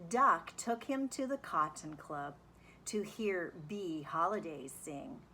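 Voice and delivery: plain reading voice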